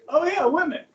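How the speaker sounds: loud, high "oh yeah" of mock surprise; hammed-up discovery of the answer; mock realization. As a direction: act like it just dawned on you.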